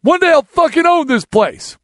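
high, loud vow yelled at the owner; retold defiant shout. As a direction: shout the promise as you get thrown out.

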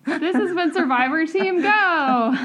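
high, drawn-out show outro; big, stretched podcast closer; announcer sign-off. Real, not acted. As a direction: belt out the show name slowly and loudly.

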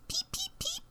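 tiny, very high peeps; high little bird peeps; chick peeping. Acted. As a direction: cheep softly like a baby chick. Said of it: "Jack met a chick".

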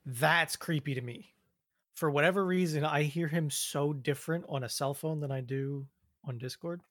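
ordinary delivery

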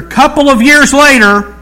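high, loud time-jump caption; mock announcement. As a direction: belt it out like a big title card.

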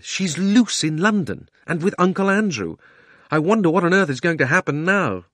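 put-on high-pitched, marvelling boy; astonished boy. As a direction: wonder aloud, high and alarmed.

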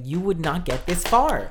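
slow, loud exasperation; exasperated. Retold or imitated. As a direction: spell it out slowly and loudly. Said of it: "I was just like".

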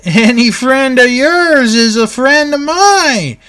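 high, drawn-out mock friendliness; Piper. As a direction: imitate a wrestler suddenly turning chummy, slow and high. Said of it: "Piper's like".

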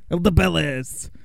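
exaggerated French accent. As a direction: say it like a mocking Frenchman. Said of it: "doing the French accent".

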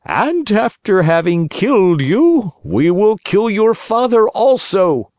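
high, cruel threat; menacing assassin. A narrator's reading. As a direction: gloat over the threat. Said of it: "assassins threaten the puppet".

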